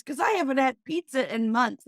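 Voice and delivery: hammed-up proud boast; imitating the dad. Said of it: "And he goes".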